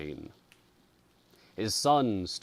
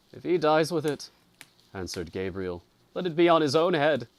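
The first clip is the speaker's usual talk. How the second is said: loud, higher, fast and hard; bitter curse; 8 semitones higher, 8 dB louder, faster than usual. A narrator's reading. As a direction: fling the curse out angrily. Gabriel answers with a curse.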